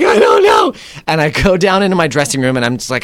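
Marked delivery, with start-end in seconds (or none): 0.0-0.7 high, loud, distraught squeal; a hammed-up frantic cry, much higher; panicked. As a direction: replay the shaken panic after the show. Panicked actor offstage, near tears.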